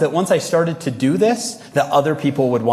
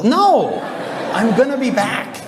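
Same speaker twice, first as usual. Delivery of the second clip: high-pitched indignant denial; exasperated mock protest; 9 semitones higher, slower than usual. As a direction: protest comically, voice leaping way up. Acted protest to dramatic wife.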